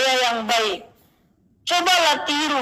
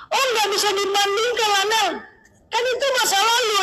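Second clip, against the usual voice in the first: high, quick mock protest; acted-out objection, pitched up and hurried; protesting friend; 8 semitones higher, faster than usual. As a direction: imitate a friend brushing off the argument. Imitates friend protesting his argument.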